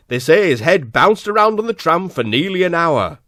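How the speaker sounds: a hint of a grave boss; stern boss. Lord Director breaks the news to Wolfenden, lightly voiced stern boss. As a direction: sound like the man in charge.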